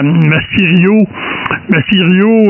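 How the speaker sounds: normal talking voice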